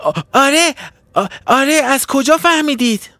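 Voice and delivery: high, stammering startled fish voice; small fish squeaking in alarm; startled cry. Acted. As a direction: blurt it out in a high panicked stammer. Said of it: "فریاد زد".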